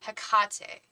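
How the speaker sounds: Hecate corrected to heh-KAH-tay, middle syllable punched; stress on second syllable. Shown as a demonstration. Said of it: "emphasis on the wrong syllable".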